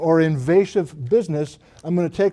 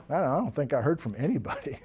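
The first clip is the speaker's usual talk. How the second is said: soft, quick, throwaway quote; offhand murmur; 3 semitones lower, 10 dB quieter, faster than usual. Retold or imitated. Colleague on Zoom reporting no response, quietly offhand.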